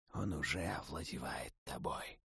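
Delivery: low, soft, calm god's voice; a death god speaking quietly from above; Mor, calm. Acted. Death god calmly warns the fallen necromancer, low and soft. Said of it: "совершенно спокойно произнёс бог смерти".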